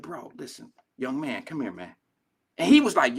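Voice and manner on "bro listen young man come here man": hushed, low-key appeal; gentle approach. Retold or imitated. Older man taking a young father aside, gently and quietly. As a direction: call him over very softly. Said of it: "I had to gracefully go to him".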